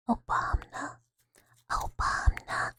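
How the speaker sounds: slow breathy whisper of Obama; ASMR whisper. Shown as a demonstration. Streamer whispering a chat trigger word. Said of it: "people just like hearing stuff like".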